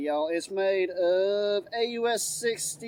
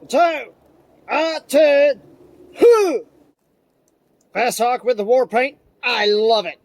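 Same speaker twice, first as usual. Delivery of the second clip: loud, high and giddy; gleeful excitement; 4 semitones higher, 10 dB louder than usual. Acted. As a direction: gush like a kid with a new toy. Gleeful over a new tomahawk.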